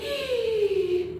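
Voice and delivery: long, high gasp; big theatrical intake of breath; shocked gasp. Acted. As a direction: gasp in mock disapproval. Teacher reacts to hitting.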